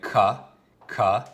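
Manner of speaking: "K, k" sounded out as kuh, kuh. Shown as a demonstration. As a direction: say "K" as a hard kuh, twice. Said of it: "if the hard C is there".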